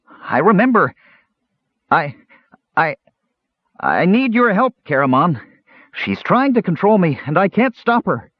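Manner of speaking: high, halting, panicked kender plea; stammer, then cry out high for help; desperate cry. A narrator's reading. Possessed kender begging his friend for help, high desperate cry. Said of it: "Earwig cried".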